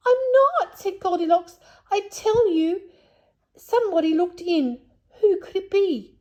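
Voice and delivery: insist, rattled and scared; frightened insisting. Acted. Goldilocks insisting to Moonface, high and frightened.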